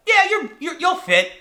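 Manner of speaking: high-pitched acted guide; tour guide's assurance. Small guide reassuring a big tourist, high-voiced. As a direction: toss out a high, hesitant assurance.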